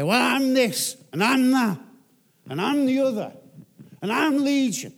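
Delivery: the demon puffing itself up, each "I'm" hammered; a strained, shouted demonic brag; demon boasting. Acted. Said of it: "he starts to say".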